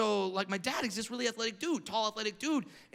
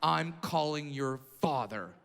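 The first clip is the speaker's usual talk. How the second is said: lower, slow disapproving voice; stern principal; 6 semitones lower, 3 dB quieter, slower than usual. Retold or imitated. Principal scolding Brad in office, low and slow. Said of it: "the principal looks at me and goes".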